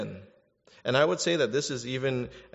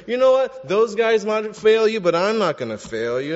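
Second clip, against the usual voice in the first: self-reliant boasting; 8 semitones higher, 9 dB louder than usual. Acted. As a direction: play an overconfident disciple making claims. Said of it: "making claims like".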